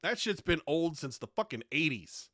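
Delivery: ordinary talk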